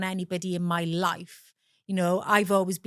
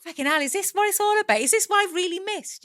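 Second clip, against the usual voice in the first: high, fast exasperated inner voice; disheartened disbelief; 11 semitones higher than usual. Retold or imitated. Woman retelling her thoughts on a spoiled night out, high exasperated disbelief. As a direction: retell your dismay, pitched right up and rushed. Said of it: "feeling so disheartened, thinking".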